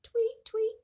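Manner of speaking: high loud chirping tweets; high bird call. Acted. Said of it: "What does the bird say?".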